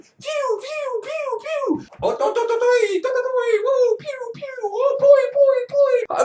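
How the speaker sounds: very high, squealed pew-pew noises; laser sounds. Acted. Furious prophetess making laser noises, squealed. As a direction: imitate cartoon lasers in a tiny high squeal. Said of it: "start making laser sounds".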